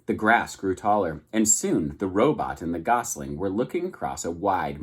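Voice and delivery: ordinary delivery, nothing marked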